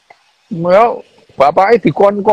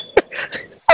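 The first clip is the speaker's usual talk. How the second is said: laughing; 11 semitones higher than usual. Real, not acted.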